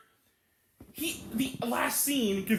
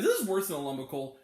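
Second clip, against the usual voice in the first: usual speaking voice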